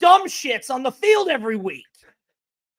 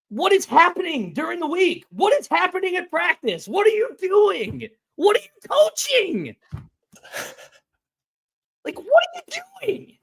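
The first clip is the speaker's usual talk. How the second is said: exasperated ranting; 5 semitones higher than usual. Real, not acted. Fan demanding answers from the coaches, exasperated ranting. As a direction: pitch climbing into a high, incredulous cry.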